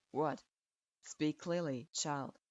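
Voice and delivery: put-on low, curt old teacher grading a student; a grudging low verdict; stern sensei voice. A narrator's reading. Strict sensei grudgingly approving his student, low and curt.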